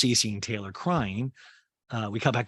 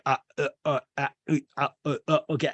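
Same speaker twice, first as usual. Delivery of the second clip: string of short, halting uhs, slightly raised; stammering; 3 semitones higher, faster than usual. Retold or imitated. Austin flustered after admitting who he went out with, stammering. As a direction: stall on repeated broken syllables before speaking. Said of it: "and he stammers".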